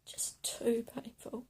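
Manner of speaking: hushed heartbroken whisper; barely voiced sorrow. Real, not acted. Speaking of painful memories.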